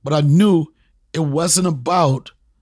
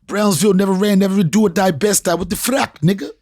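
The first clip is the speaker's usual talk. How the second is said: Brooklyn stage hype; 2 semitones higher, 3 dB louder than usual. Retold or imitated. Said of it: "make a reference to a joke and be like".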